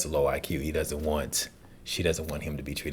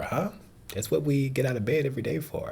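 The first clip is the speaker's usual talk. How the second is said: normal talking voice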